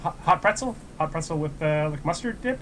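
a dim customer trying another order; goofy hopeful teenager ordering; clueless customer. Retold or imitated.

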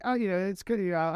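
normal talking voice

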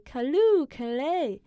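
"callooh" rung out high and loud as the poem's climax; joyful poem cry. Acted. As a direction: let the joy burst out on "callooh, callay". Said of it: "You wanna try reading it really quick?".

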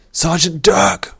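high, loud, drawn-out summons; commanding call to a subordinate. A narrator's reading. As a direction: shout for the sergeant down the train. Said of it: "Vor called".

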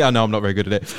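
normal talking voice

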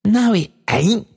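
high, slow, drawn-out gleeful cry; burst of delight. A narrator's reading. Daughter crows at father's wrong guess, delighted. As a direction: crow the denial with delight. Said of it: "cried Meg in a burst of delight".

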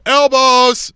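high, loud sideline call; re-enacted yell. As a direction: call out the foul across the field. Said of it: "I'm yelling them out".

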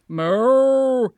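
short cow moo. Acted. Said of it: "adding a few moos".

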